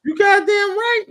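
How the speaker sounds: high, slow, drawn-out shout; loud emphatic agreement. Real, not acted. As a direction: belt out the agreement.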